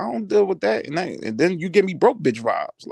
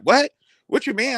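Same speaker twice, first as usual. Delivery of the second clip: higher, louder mock-offended "what?"; playing an indignant comeback; mock taken aback; 7 semitones higher, 3 dB louder than usual. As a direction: imitate someone caught off guard and objecting. Acted offended reply to rejection.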